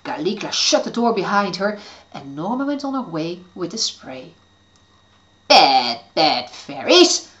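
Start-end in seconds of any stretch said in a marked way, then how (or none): 5.5-7.3 low, slow grumbling witch; grumbled. Acted. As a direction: growl it low and slow under your breath. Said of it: "grumbled Caligula".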